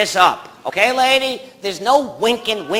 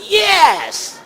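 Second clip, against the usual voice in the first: high shouted yes; mock retort shout; 11 semitones higher than usual. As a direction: fire it back at them. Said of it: "And I'll say".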